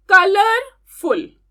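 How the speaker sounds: कलरफुल slowly and loudly, कलर, pause, फुल; teacher breaking up कलरफुल for the class; syllable by syllable. Shown as a demonstration. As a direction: sound out कलरफुल in slow separate parts. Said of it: "तो three syllables हैं".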